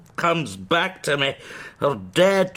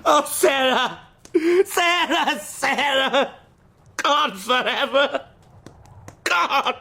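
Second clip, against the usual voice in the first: a higher-pitched lament breaking into laughter; mad grief; 7 semitones higher, slower than usual. Acted. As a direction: laugh and grieve at once as the mind goes. Madman mourning, mind collapsing.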